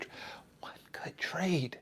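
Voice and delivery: slow hushed whisper; awed little whisper. Real, not acted. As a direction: breathe the words out slowly. Hushed repeat.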